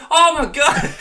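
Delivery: very high, loud, laughing oh my God; overjoyed squeal. Retold or imitated. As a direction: gush with joy. Overjoyed waiter reaction retold.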